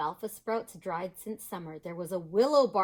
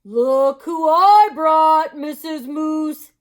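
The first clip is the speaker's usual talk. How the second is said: slow, loud, friendly moose; 8 semitones higher, 16 dB louder, much slower than usual. Acted. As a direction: draw it out slowly and loudly. Mister Moose voice.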